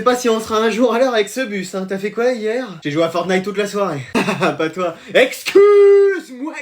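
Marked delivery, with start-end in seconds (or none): 5.1-6.5 very high, slow, drawn-out excuse me; indignant. Acted. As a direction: stretch it out in outrage. Outraged at seat taken.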